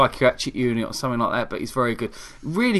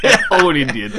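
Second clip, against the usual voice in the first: cracking up, a bit louder; laughing; 2 semitones higher, 4 dB louder than usual. Real, not acted. Repeating the joke to co-host, laughing. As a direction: say it through real laughter. Cracking up at own failed accent.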